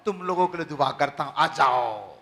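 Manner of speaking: ordinary talk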